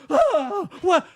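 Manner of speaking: mock shocked pastor. Pastor realizing too late.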